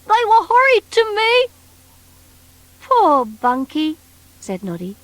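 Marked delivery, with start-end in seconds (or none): 0.1-1.5 high, funny little creature voice; bunkie's voice. Acted. Bunkie telling Noddy about himself, high creature voice. 2.8-4.0 sympathetic. Acted. Noddy sympathising.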